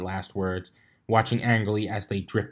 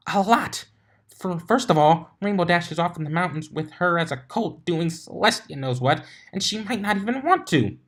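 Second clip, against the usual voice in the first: Twilight's voice; 8 semitones higher than usual. A narrator's reading. Twilight voiced, high and worried.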